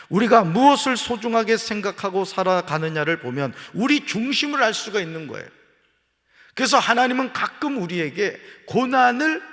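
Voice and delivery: loud, high pulpit preaching; fervent preaching. Real, not acted. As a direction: drive it home to the congregation. Preaching at full force.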